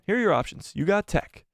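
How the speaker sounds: usual speaking voice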